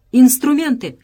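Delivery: loud, high-pitched outburst; crying out. A narrator's reading. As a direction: cry it out loud as the searching engineer. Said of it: "вскричал он".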